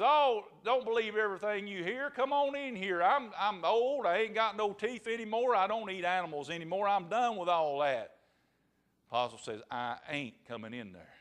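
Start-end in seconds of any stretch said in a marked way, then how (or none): 0.0-8.2 higher-pitched wheedling as the wolf; storyteller's pleading wolf; coaxing old wolf. Acted. Wolf coaxes the possum. 9.7-11.1 low, muted possum refusal; storyteller's subdued possum voice; stubborn possum. Acted.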